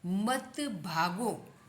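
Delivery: drawn-out reading of the phrase; slow model. Shown as a demonstration. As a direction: stretch it out for learners. Slow headword, later said as command. (धीरे-धीरे खींचकर पढ़ते हुए)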